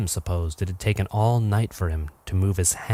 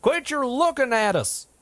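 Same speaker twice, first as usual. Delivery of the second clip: yelling. A narrator's reading. Charlie yelling at the staring giant. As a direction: shout it so the mountain hears. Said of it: "Charlie yelled".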